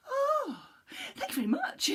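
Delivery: high, squeaky, fast panicked little voice; a tiny cookie in a flap; high panicky little gingerbread man; frightened gingerbread man. Acted.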